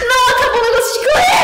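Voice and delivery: high fast outraged shout. Real, not acted. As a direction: yell in frustration. Caught by monster, outraged.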